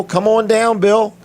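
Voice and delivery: high, upbeat show-host call; calling guest up. Real, not acted. Host brings Bill onto the show, calling out. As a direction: summon the next guest, pitched high.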